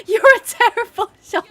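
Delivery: high, loud laughing yell; cracking up while reading the insult; laughing shout. Real, not acted. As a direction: blurt it out through laughter. Reading a teasing chat comment.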